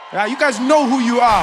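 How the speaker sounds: loud call-out to the crowd. Real, not acted. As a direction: shout it at them playfully. Calling out the crowd.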